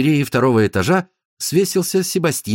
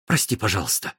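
plain reading voice

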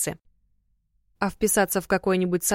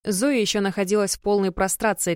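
ordinary delivery

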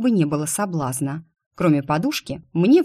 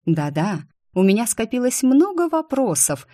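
ordinary delivery, nothing marked

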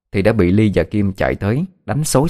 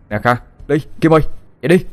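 urgent call, rising much higher; 7 semitones higher, much faster than usual. Acted. Minh calls his friends awake at night, urgently. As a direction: bang on doors and shout them awake. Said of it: "tiếng kêu của Minh ồn ào".

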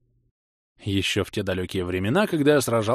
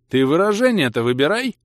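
raised, loud rebuke; snarling; 7 semitones higher, 5 dB louder than usual. A narrator's reading. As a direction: snap back a warning. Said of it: "рыкнул я". (рыкнуть с возмущением)

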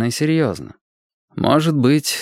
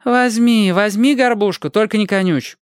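higher, tired big-sister tone; weary sigh; 11 semitones higher than usual. A narrator's reading. Tina gives the whining boy the crust, wearily. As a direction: give in with a sigh. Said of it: "вздохнула Тина".

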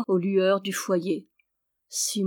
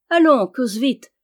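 louder, higher burst of impatience; impatient cry; 4 semitones higher, 7 dB louder than usual. A narrator's reading. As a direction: call out, hurrying them along. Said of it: "s'écria Simon". (exclamation impatiente, plus forte et plus aiguë)

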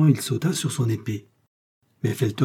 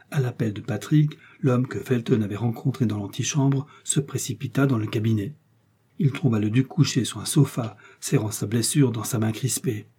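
usual speaking voice

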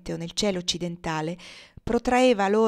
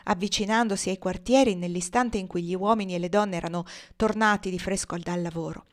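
usual speaking voice

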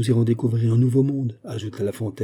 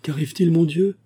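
higher-pitched anxious cry; alarmed question; 7 semitones higher, 4 dB louder than usual. A narrator's reading. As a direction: ask in alarm, voice lifted. Friends alarmed at Fouquet's look. (question alarmée, voix plus aiguë)

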